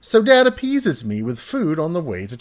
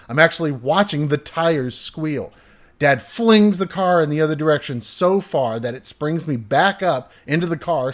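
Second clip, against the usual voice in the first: ordinary talk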